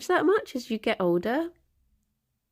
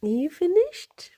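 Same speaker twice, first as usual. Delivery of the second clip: pet talk; 8 semitones higher than usual. Real, not acted. To her cat after its mischief, high cooing question. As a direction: coo the question at a naughty pet.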